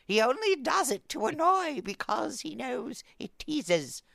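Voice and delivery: very high theatrical storybook voice; put-on high nursery-rhyme voice; Alice in Wonderland character. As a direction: lift it way up into a singsong recital. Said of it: "I'll do it in that voice".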